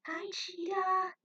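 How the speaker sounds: put-on high, slow childish hello; a small child saying hi; kids' greeting. A narrator's reading. Kids greet Cheetah.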